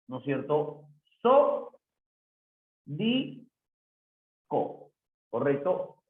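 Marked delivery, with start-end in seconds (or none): 1.2-4.9 "So, di, co" one at a time with long pauses; separate syllables. Shown as a demonstration. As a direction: count out "So, di, co" as isolated syllables. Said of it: "esta palabra tiene cuatro sílabas".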